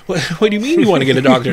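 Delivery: loud, high, rushed disbelief; retold alarm. Patient answering talk of getting a doctor, alarmed. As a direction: blurt it out fast in mock panic. Retells alarmed reply to nurse.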